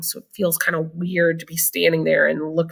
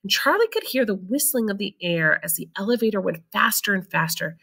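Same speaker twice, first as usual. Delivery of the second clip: plain reading voice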